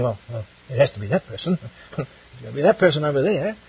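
low, quick, soft aside; mock evasive mumble with a little laugh; passing the buck. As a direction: imitate someone shrugging the job onto another, hurried and quiet. Mimics people passing responsibility on.

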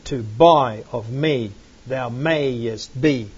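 slow, measured, lifting the ends; "Nakedness, I counsel thee" read slowly to show the rhythm; rhythmic reading. Shown as a demonstration. Teacher reads scripture slowly to show its rhythm. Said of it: "how the stress is going up on the ends".

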